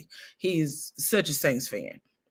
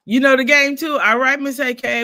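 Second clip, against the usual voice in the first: louder, higher call to cohost; 7 semitones higher, 7 dB louder than usual. Real, not acted. Teasing her cohost Miss A K about football.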